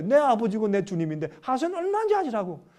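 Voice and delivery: usual speaking voice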